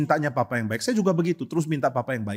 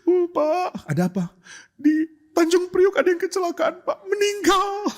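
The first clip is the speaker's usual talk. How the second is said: much higher, put-on voice of a tearful stranger; weepy churchgoer. Congregant crying to the pastor over a stranger, much higher teary voice. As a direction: play a teary congregant overdoing the grief. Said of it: "datang ke gereja nangis-nangis".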